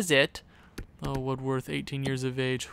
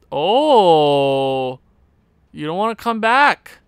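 indignant shout; 10 dB louder, slower than usual. Real, not acted. Player refuses, outburst.

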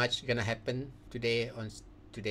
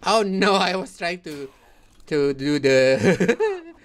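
laughing; 6 semitones higher, 14 dB louder than usual. Real, not acted. Laughs at his flub.

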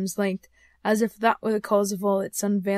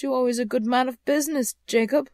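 a touch of hesitation, slightly raised; faltering; 4 semitones higher, 4 dB louder than usual. A narrator's reading. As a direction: falter mildly while trying to placate. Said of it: "faltered Scrooge".

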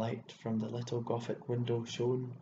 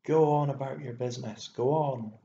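loud, rough dismissal; loud, impatient cry; 3 semitones higher, 8 dB louder than usual. A narrator's reading. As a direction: shout them away roughly. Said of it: "she cried again".

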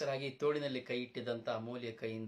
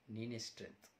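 quiet admission; 8 dB quieter than usual. Acted. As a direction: say it softly, giving in. Son conceding quietly, then shouting. (ಮೆಲ್ಲಗೆ ಒಪ್ಪಿಕೊಳ್ಳುವ ಮಗ)

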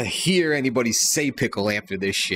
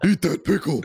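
trying Patrick voice. Acted. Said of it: "did that sound anything like him".

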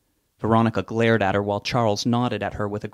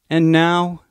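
lightly voiced officiant, a touch higher; funeral director; 7 semitones higher, 7 dB louder than usual. A narrator's reading. Officiant addressing the mourners, lightly voiced. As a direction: announce it like a minister at the podium.

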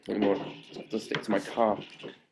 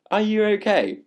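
squealed question; 12 semitones higher, 9 dB louder than usual. Real, not acted. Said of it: "That was asked by [name]".

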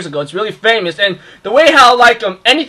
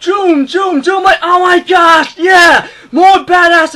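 hyped shout; 8 semitones higher, 4 dB louder, slower than usual. Retold or imitated. Hyped by wall jumping scene.

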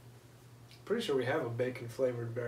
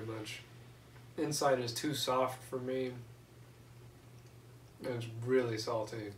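speaking past a raised finger; finger against lips. Real, not acted. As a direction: hand resting at mouth. Seen 1: Left speaker holds index finger against lips and nose throughout.

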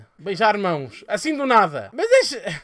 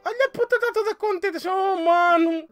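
mocking squeal; 11 semitones higher, 5 dB louder than usual. Real, not acted. Teasing the Sims character.

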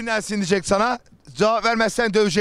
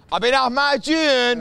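higher, slow drawn-out call; street vendor call; 4 semitones higher, much slower than usual. Acted. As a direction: stretch it out to passers-by. Hawking lahmacun again.

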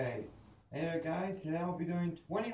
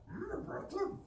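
very high wise little creature; Yoda. Acted. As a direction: croak it like a tiny old Jedi.

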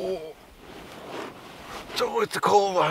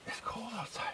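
whispered, no voice; 7 semitones higher, 17 dB quieter than usual. Real, not acted. Owner whispering to dog about the cold. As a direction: breathe it out softly.

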